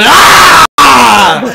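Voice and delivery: loud, high imitated roar; hammed-up angry ape yell; mock yelling. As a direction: roar like King Kong beating his chest.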